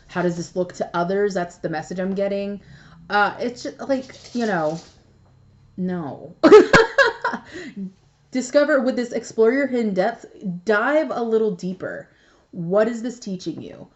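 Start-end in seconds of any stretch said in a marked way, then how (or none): 6.4-7.9 loud laugh. Real, not acted. Laughs at own aside.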